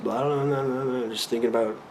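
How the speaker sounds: mock mental chatter; imitating racing mind. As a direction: babble like a mind running on. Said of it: "my mind is just".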